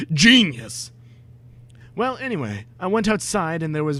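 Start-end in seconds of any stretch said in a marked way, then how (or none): 0.1-0.9 loud bursting genius; mock eureka; triumphant. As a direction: cheer your own brilliant idea. Mock triumph at own idea.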